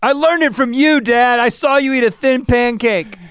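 high, loud mock-anguished kid; caught kid's outburst. Son caught stealing from dad's wallet, mock outburst. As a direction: yell it like a cornered child, hammed up. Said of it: "bust in the room and he's like".

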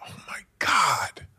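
slow, breathy moved whisper; tearful whisper. Retold or imitated. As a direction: let it catch in your throat. Retelling his breakthrough moment.

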